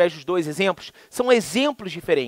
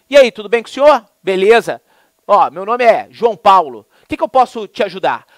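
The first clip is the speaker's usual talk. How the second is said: brash clerk; 7 dB louder than usual. Retold or imitated. Clerk greeting the customer.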